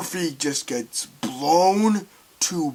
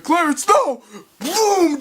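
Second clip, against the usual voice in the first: high, loud scream of terror; mock terrified scream; 12 semitones higher, 4 dB louder, slower than usual. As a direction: scream as you're thrown out. Victim screaming as thrown out.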